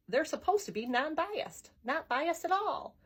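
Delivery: retold objection, pitch climbing; indignant. Husband objects to the biased city official, mildly indignant.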